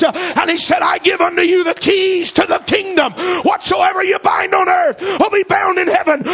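loud, slow, high-pitched preaching shout; full-force preaching. Acted. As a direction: thunder it out to the congregation.

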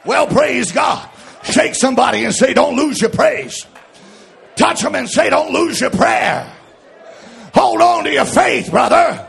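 shouted, ringing sermon cadence; rousing pulpit shout over music; full-force preaching. Acted. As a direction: preach it out to the whole church.

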